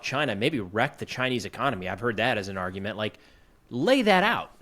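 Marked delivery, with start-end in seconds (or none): 3.7-4.5 emphatic demand. Real, not acted.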